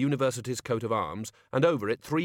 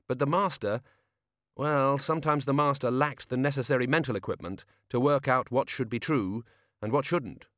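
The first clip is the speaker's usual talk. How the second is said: ordinary delivery, nothing marked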